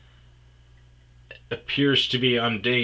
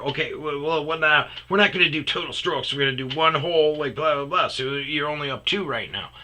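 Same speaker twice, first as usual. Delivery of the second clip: imitating a bossy competitor; 5 semitones higher, 4 dB louder, faster than usual. Imitating her bossy competitive rules.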